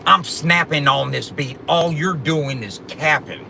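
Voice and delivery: slow, deliberate rap delivery; slow the bars down so they can follow. Shown as a demonstration. Rapper slowing his bars for the chat, deliberate. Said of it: "I had to slow it down for you".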